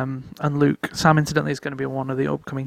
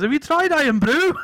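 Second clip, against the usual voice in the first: higher-pitched mock Scottish accent; imitated Scottish accent; 11 semitones higher than usual. Friend chiming in to a tourist, mock Scottish accent. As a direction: put on an excitable Scottish lilt, pitched up. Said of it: "Like this".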